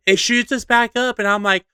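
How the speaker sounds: normal talking voice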